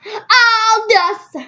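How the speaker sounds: shrieking high German mama cry; loud hammed-up German cry. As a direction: wail it in a mock German accent. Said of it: "I'm trying to have that German voice".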